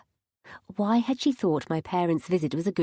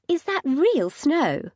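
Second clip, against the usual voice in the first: excited mum voice; 8 semitones higher, 4 dB louder, slower than usual. A narrator's reading. Mum marvels at snow.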